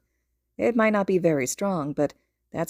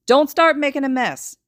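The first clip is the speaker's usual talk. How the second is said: loud, higher stern mom command; snapping warning; 8 semitones higher, 7 dB louder than usual. A narrator's reading. Mother snaps a warning at her son. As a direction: bark the rule at him. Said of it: "she snaps".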